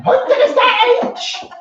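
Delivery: very high, slow witchy cackle voice; Wicked Witch voice. Retold or imitated. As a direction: do a shrill Wicked Witch of the West. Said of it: "I made her sound like the Witch of the West".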